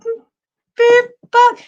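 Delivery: high hammed-up surprise; mock amazed exclamation. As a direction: play the listener spotting the bugs, all gasp and wonder. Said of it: "You go".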